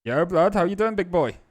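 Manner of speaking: high, loud mock street greeting; mock buddy greeting. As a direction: greet the board like a homie, hammed up. Mock greeting to broken board.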